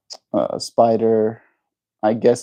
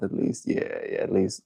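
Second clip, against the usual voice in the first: usual speaking voice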